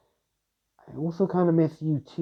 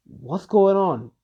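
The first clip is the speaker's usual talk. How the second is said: higher, slow alarmed cry; startled question; 6 semitones higher, 4 dB louder, much slower than usual. Acted. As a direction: ask it slowly, startled and uneasy. Characters startled by purple sky.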